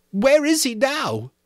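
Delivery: put-on high, loud, breathless boy; gasping Piggy. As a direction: gasp it out, high. Piggy pleading.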